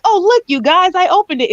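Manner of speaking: high, loud, proud and giddy; excited Ned. Retold or imitated. Retelling Ned opening the portal, giddy and high. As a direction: show off like a kid who just did magic. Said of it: "Ned was like".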